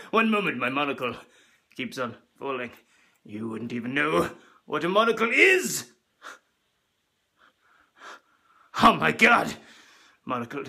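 pompous upper-class toff voice; hammed-up snooty aristocrat; posh rich man. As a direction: lord it over the poor peasants. Posh rich man character throughout.

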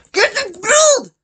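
very high, loud yells; high shouted orders. Real, not acted. Gamer shouting at the game to grab it, very high and loud. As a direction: shout it at the screen.

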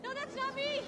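high, desperate yelling; frantic warning. Acted. Mother warns her children off the fake voice, panicked and high. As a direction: cry out to stop them in panic.

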